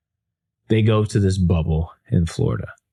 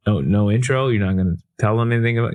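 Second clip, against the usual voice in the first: ordinary delivery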